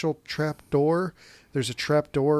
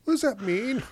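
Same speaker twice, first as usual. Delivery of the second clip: put-on high, puzzled little kid asking; confused child voice; 10 semitones higher than usual. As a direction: ask it like a baffled child. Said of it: "I distinctly remember the, like".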